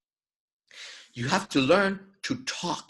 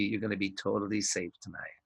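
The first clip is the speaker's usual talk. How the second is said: softer, lower sinister promise; creepy movie villain; 5 semitones lower, 9 dB quieter than usual. Shown as a demonstration. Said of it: "like a creepy movie".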